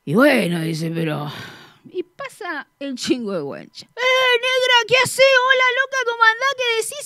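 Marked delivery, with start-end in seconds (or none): 3.9-7.1 high, loud call-out from a passing taxi; saludo a los gritos. Retold or imitated. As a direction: hail a friend loudly, pitched way up. (saludo gritado, muy agudo, de taxista)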